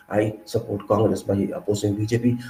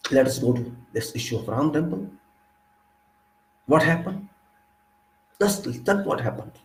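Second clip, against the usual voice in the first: usual speaking voice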